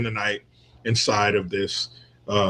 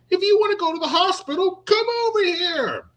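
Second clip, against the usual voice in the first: aggressive guy. Retold or imitated.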